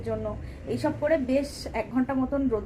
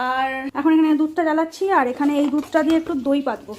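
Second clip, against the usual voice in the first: normal talking voice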